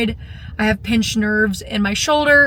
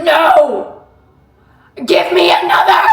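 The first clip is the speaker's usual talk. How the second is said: loud, higher shout with a laugh; defiant shout; 5 semitones higher, 8 dB louder, slower than usual. Retold or imitated. Telling how she begged the coach for more, a laughing defiant shout. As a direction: yell it back, refusing to quit. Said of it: "And I was like".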